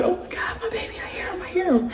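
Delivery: high, breathless panicked whisper; mother hears her missing baby behind the door; frantic whisper. Retold or imitated. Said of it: "I'm like".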